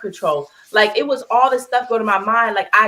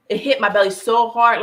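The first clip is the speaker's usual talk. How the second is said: usual speaking voice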